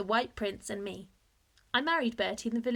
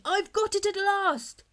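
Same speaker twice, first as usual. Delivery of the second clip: much higher, louder, slow triumphant cry; excited cry; 10 semitones higher, 6 dB louder, slower than usual. A narrator's reading. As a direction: burst in shouting with discovery. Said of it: "he cried as he came in".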